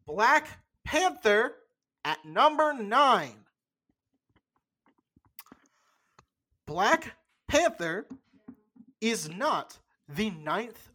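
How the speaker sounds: slow, heavily punched words, loud at the peaks; indignant disbelief. Real, not acted. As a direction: spell out your objection emphatically, word by word. Indignant rant against the list pick.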